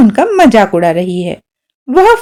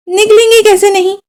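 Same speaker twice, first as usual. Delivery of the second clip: higher, indignant question; defiant retort; 10 semitones higher, faster than usual. A narrator's reading. Father insisting the sparrows will go, higher indignant retort. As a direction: refuse to be beaten by birds. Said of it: "पिताजी बोले".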